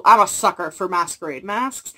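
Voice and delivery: normal talking voice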